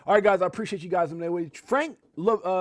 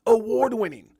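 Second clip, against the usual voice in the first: booming announcer call; 5 semitones higher, 7 dB louder than usual. Shown as a demonstration. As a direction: shout it out loud, hype announcer. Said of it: "that just sound dope as hell".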